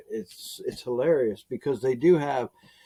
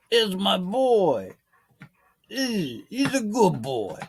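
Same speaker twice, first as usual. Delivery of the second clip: high, slow mock gangster crooning to the dog; 8 semitones higher, much slower than usual. Talking to his dog in a gangster voice. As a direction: talk to the dog like an old movie mobster. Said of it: "like the gangster".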